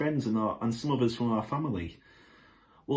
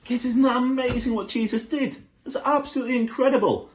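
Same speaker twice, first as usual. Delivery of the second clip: much higher and louder, thrilled; excited; 12 semitones higher, 5 dB louder than usual. Real, not acted. Presenter marvels at the miracle to children, excited.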